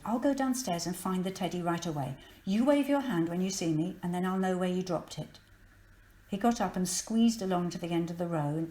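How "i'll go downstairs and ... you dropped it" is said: ordinary delivery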